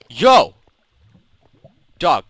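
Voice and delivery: loud, high shout of "Yo!"; calling out sharply. Real, not acted. Player yells at the dog that got up, sharp shout. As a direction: yell to get the dog's attention.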